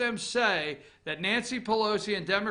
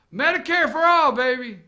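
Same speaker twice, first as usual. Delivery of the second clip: mocking; 3 semitones higher, 8 dB louder than usual. Mocking slogan, loud.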